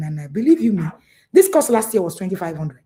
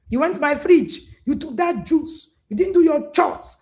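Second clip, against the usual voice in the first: higher, louder accusing parent; an exasperated parent listing the child's wrongs; mock scolding parent; 8 semitones higher, 3 dB louder than usual. Parent scolding child over juice.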